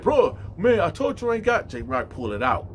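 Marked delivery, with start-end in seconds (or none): 0.0-1.7 high-pitched retold protest; loud opening, defensive and flustered; pleading denial. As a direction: act out someone caught out. Inmate protests, caught out.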